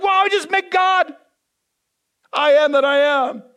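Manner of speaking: awed Moses. Retold or imitated. Moses just after meeting God, awed and loud.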